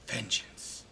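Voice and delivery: vengeance breathed in a soft whisper; Posthumus whispering vengeance. Acted. Betrayed husband alone, muttering revenge in a whisper. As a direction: let the word die to a whisper. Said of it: "heartbroken and very angry".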